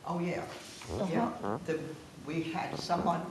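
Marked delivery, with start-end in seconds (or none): none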